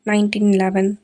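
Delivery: ordinary delivery, nothing marked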